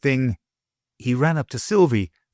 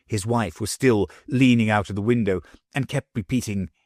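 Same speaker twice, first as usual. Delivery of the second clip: ordinary delivery, nothing marked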